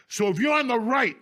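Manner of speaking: ordinary talk, nothing marked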